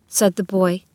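ordinary delivery

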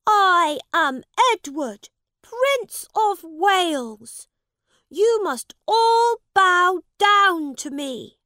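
put-on commanding young prince. Prince demands the boys bow.

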